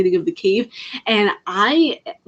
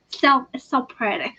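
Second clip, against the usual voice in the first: dreamy gushing; 5 semitones higher than usual. Acted. Dreamy repeat of pretty.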